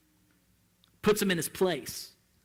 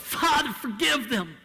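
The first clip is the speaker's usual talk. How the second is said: yelled; 5 semitones higher than usual. Shown as a demonstration. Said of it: "He yelled".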